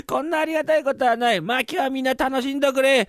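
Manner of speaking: high-pitched, jubilant servant; merry carousing. Acted. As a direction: cheer the whole party on. Servant revels while master is away.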